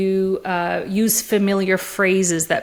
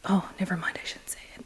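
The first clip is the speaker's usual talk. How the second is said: retold whisper; 12 dB quieter, much faster than usual.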